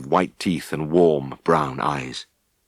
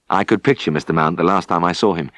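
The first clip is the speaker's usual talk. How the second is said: plain reading voice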